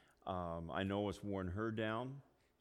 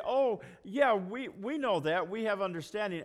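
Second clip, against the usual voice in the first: mock know-it-all; 11 semitones higher, 8 dB louder than usual. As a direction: play the self-assured listener, hammed up. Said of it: "you say".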